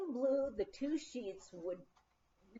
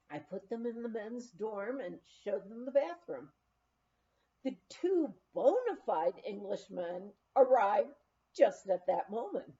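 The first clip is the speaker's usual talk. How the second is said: ordinary delivery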